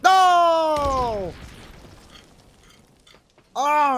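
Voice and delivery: high, loud despairing cry; anguished shout. Real, not acted. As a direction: yell out as you lose. Dying in game.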